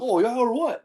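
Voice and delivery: high-pitched aggressive comeback; hammed-up spoiling for a fight; belligerent challenge. Dad challenging the referee, mock belligerent.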